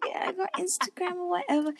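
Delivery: high, airy and coy; mock-casual flirty reply; coy nonchalance. Retelling own coy reply to flirt.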